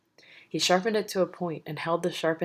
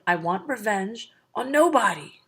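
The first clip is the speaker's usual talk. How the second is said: high, loud pained giant's roar; bellowed; 6 semitones higher, 5 dB louder than usual. Acted. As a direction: bellow it out in fury and pain. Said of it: "Polyphemus bellowed".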